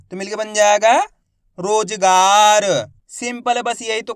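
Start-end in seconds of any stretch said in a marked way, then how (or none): 1.6-2.6 रोजगार said very slowly and louder; slow blended word. Shown as a demonstration. Teacher blends रोजगार for pupils, very slow. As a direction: glide the syllables into one slow word. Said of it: "मेरे तरीके पे गौर करना है".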